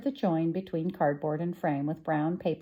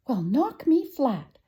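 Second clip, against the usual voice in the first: slow, louder, higher astonishment; drawn-out amazed exclamation; astonished; 7 semitones higher, 6 dB louder, slower than usual. Acted. Shop woman amazed by the boy's tale, slow astonished exclamation.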